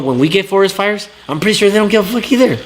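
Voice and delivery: worked-up rant at the other coast; heated rant. Real, not acted. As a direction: let the frustration spill over. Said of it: "Sorry, I went on a rant".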